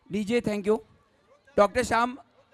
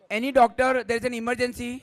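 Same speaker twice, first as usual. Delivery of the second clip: emergency shout; 4 semitones higher, 6 dB louder than usual. Real, not acted. Calling out for emergency doctor.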